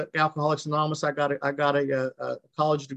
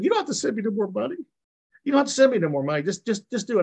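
excited high quote; 9 semitones higher than usual. Retold or imitated. Woman's joyful phone call, retold.

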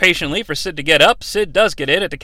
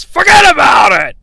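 loud, high commentator's yell; Vince; 6 semitones higher, 6 dB louder than usual. Acted. Commentator calling an avalanche, yelling. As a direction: belt it out from the commentary desk. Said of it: "and Vince yells".

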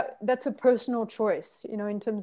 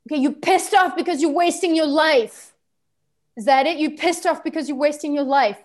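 loud, raised, emphatic mirroring; forceful repeating back; 4 semitones higher, 7 dB louder than usual. Shown as a demonstration. Trainer showing how to repeat back anger, forceful. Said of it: "sometimes you can repeat back".

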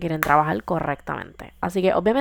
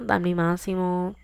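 normal talking voice

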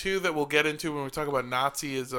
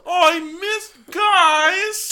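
whiny shout; 13 semitones higher, 8 dB louder, much slower than usual. Pretend. Acting late arrival missing the war.

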